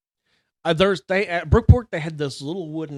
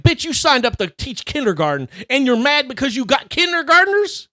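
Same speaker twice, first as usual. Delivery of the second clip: indignant; 8 semitones higher, 7 dB louder than usual. Retold or imitated. Telling off the old teacher.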